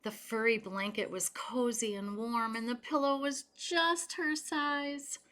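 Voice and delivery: gentle read-aloud storytelling voice; story narration. Acted. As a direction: read it to children at bedtime. Reading picture book aloud.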